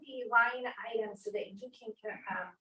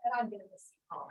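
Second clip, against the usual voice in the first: ordinary talk, nothing marked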